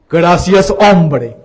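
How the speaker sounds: loud, hearty mimicry of a catchphrase; emphatic mimicked thanks. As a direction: belt out the thanks as an imitation. Repeats thanks in the priest's catchphrase style.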